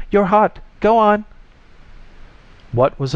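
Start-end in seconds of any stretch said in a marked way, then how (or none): 0.1-1.2 high, loud, excited shout; loud cries pitched high. A narrator's reading. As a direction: urge them on at a shout. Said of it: "the voice shouted".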